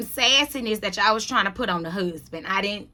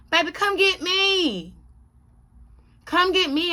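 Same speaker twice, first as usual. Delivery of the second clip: sassy challenge; 8 semitones higher than usual. Acted. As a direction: taunt her to come face you. Daring the castmate to confront her.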